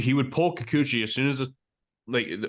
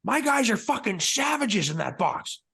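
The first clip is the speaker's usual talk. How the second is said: much higher, slower, mock fired-up rant; hammed-up manager chewing out the ump; manager yelling at umpire; 14 semitones higher than usual. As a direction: play the angry skipper in the umpire's face, pitched way up. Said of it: "get in the ump's face and say".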